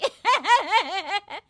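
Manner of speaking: high, loud jeering laugh; mocking laugh. Neighbours jeer at the mother-in-law, loud high laugh. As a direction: laugh at her expense.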